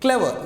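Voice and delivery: "clever" with the first syllable punched high, falling away; stress on first syllable. Shown as a demonstration. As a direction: hit the start of "clever", let the end drop. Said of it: "You have to give one stress".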